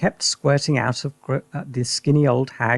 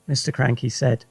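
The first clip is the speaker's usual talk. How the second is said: ordinary delivery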